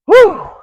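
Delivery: high, loud exhaled whew; whew of relief. Acted. Wife learns the servants are spared, relieved whew. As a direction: let out a big, high whoop of relief. Relief after danger is averted.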